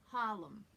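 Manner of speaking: "Harlem" New York style, as HAH-lem; dropped R. Shown as a demonstration. Coach gives the first example word, dropped R. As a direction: say "Harlem" with no R at all. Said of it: "no R in the word Harlem".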